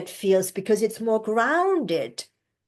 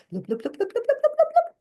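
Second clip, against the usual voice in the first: quick little breath sips, loop loop; 14 semitones higher, much faster than usual. Shown as a demonstration. Coach showing how to drop the breath in. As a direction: sip the air in short loops. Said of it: "and then you go".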